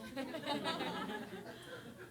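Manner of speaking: a real high laugh that fades off. Real, not acted. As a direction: laugh lightly at the joke. Laughs at his own joke.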